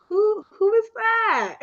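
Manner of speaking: incredulous question. Retold or imitated. Retold shocked question at event.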